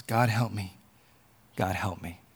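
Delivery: low, hushed prayer; quiet desperate plea. Acted. Man prays as the lizard is killed, quiet low plea. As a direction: sink into a soft plea to God.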